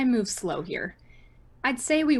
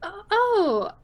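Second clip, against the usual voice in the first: high, slow, confused "I'm so sorry?"; stunned, unsure sympathy; student reacting to shocking news, much higher; awkward shock; 8 semitones higher, 3 dB louder, slower than usual.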